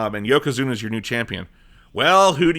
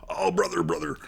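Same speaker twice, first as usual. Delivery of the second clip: Hogan catchphrase; 7 dB quieter than usual. Retold or imitated. Hogan standing over the blinded Bret, hushed mock catchphrase. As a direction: breathe out the catchphrase like a tired Hogan.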